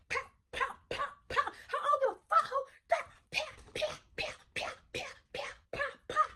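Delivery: soft breathy pow pow punch noises; punch sound effects. Retold or imitated. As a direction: mouth the hits, some barely voiced. Imitating punches to her face.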